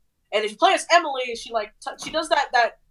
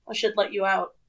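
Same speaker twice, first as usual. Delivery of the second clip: ordinary talk, nothing marked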